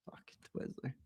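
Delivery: under-breath whisper; whispered curse. Real, not acted. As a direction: mutter it off-mic under your breath. Whispered aside.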